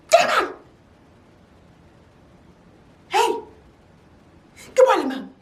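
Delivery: high startled then fake casual; jump, then cover with a cheery hello; startled yelp. Sneaking out, caught by OC.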